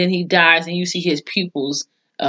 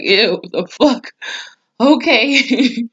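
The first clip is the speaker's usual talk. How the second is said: disgusted; 5 semitones higher, much slower than usual. Retold or imitated. Own disgusted reaction to ghost maid.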